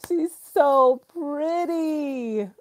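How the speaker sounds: slow, high, adoring coo; gushing. Real, not acted. Gushing over baby photos.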